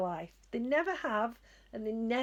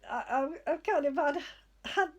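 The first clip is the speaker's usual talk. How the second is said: normal talking voice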